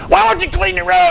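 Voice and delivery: put-on high, loud snapping at a kid; angry outburst. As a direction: blow up at the messy child. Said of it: "just lost it and said".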